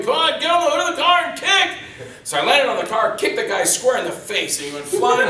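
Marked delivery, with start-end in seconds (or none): 0.0-1.8 high, loud, impatient shout; imitated yelling. Stunt coordinator pushing the stuntman to kick, mock yelling. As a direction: holler the order in mock exasperation. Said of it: "he's yelling at me".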